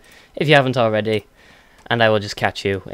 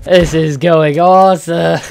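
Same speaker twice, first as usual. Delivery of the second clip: sarcastic; 5 semitones higher, 7 dB louder, slower than usual. Real, not acted. Sarcastic after dying.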